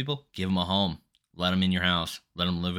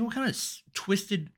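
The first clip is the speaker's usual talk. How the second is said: retold disbelief; 13 semitones higher than usual. Acts out disbelief at looming fight.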